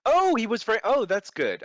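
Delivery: high, bright relieved reaction; relieved surprise. Real, not acted.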